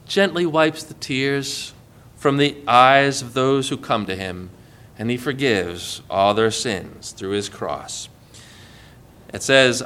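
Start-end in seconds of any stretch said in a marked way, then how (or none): 0.1-8.1 gentle. Real, not acted. Preacher on hands that wipe tears, gentle. Grace contrasted with crushing power.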